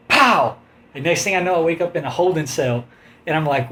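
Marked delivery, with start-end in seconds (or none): 0.1-0.6 sharp spoken impact pow; punchy pow sound effect; loud pow sound effect. Retold or imitated. Imitated blow.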